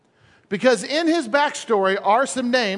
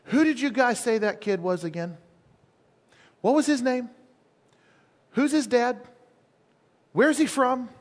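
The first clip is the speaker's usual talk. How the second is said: higher, hushed, suspicious questioning; Saul's probing questions; 3 semitones higher than usual. Acted. Jealous King Saul asking about young David, higher pointed questions. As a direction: ask casually, with long pauses between questions. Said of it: "Saul begins asking questions".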